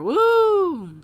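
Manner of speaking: long high-pitched "woo". Real, not acted. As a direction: whoop on one high held note. Cheers mid recap.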